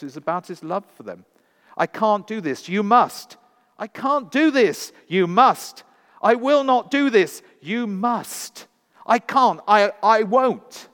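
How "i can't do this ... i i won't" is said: much higher, much louder desperate refusal; anguished cry. A narrator's reading. Mackenzie refusing the choice, desperate anguished shouting. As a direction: cry out against it.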